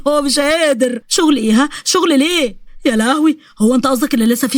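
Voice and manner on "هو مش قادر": plain reading voice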